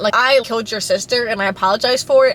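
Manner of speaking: ordinary talk, nothing marked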